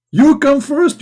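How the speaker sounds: very high, loud insistence; hammed-up yelled command; mock shout. Shouted quote inside mock threat.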